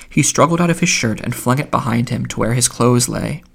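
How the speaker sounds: ordinary delivery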